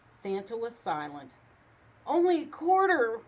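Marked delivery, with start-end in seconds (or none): 2.1-3.2 high, loud bargain pitch; haggling. A narrator's reading. Rump dropping his price to Santa, high and loud. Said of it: "Rump continued".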